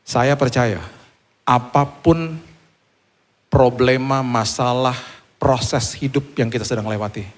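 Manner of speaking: normal talking voice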